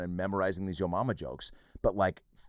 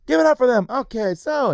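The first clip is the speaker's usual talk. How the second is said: much higher, louder chipper host voice; oblivious emcee; 9 dB louder than usual. Retold or imitated. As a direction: sell it like a clueless cheery emcee. Mocking oblivious host launching in.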